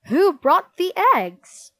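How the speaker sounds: high, loud stern question; cold, forceful interrogation; Miss Battle Axe's icy demand. A narrator's reading. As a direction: demand it like a furious teacher. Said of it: "Her voice was like ice".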